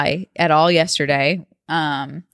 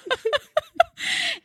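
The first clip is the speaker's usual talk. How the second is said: high laugh; 4 dB quieter than usual. Real, not acted. Co-host joke about chest hurting.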